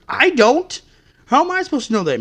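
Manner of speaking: furious outburst at careless customers; angry shout. Real, not acted. Ranting at customers who leave.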